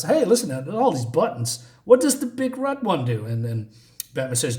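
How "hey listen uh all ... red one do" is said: high, swooping playful villain voice; Joker. Acted. Joker pestering Batman about the buttons, playful high villain voice. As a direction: play it pitched up, lilting on the question. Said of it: "Joker is being Joker, so he says".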